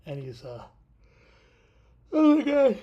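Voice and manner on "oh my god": words stretched out through a real yawn, much higher and louder. Real, not acted. Tired host yawning mid-story to viewers, words pulled through a yawn. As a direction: let a big yawn carry the words. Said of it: "sorry I'm yawning".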